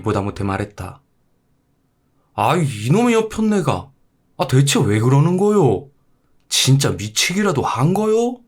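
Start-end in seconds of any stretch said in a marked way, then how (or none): 2.3-8.4 exasperated husband. Acted. Husband losing patience with his chanting wife, exasperated. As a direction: snap at your wife in disbelief.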